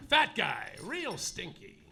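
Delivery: ordinary delivery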